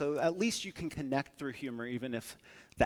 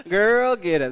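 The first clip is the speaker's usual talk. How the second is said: loud cheer; 5 semitones higher, 11 dB louder, slower than usual. Real, not acted. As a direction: cheer her on at full volume. Cheering someone on loudly.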